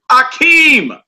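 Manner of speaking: high, loud held-out AKEEM; movie-style name call. Acted. Teacher quoting Coming to America, high drawn-out call. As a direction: call out "Akeem" like a comedy film line. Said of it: "that was Eddie Murphy's name on Coming to America".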